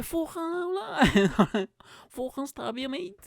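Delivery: put-on high, stretched rowdy northern English accent; mock northern English threat; rough northern English accent. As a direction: ham up a wild northern English bloke. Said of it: "Why up north is like".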